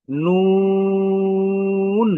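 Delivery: নুন with a very long, loud, steady oo; drawn-out letter name. Shown as a demonstration. Teacher models the letter's name, long-drawn. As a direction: hold the oo of নুন high and even. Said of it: "আমরা এটাকে দীর্ঘ টান দিতে পারি".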